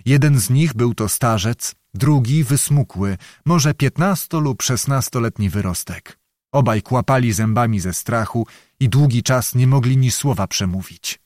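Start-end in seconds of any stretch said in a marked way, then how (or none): none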